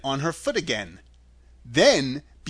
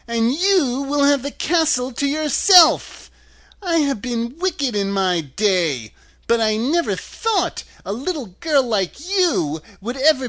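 high, wailing witch voice; dying, despairing witch; 10 semitones higher, 4 dB louder than usual. A narrator's reading. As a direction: lament in a high crone's wail. Dying witch's last speech.

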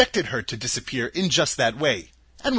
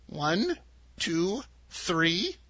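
softer, slow solemn count; 4 semitones higher, 5 dB quieter, much slower than usual. A narrator's reading. Little old woman counting as her cap turns to slate, solemn and soft. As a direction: count like a grave ritual. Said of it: "in a solemn voice".

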